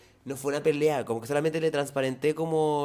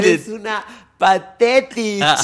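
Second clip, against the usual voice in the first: mock insult, laughing; 5 semitones higher, 13 dB louder, much slower than usual.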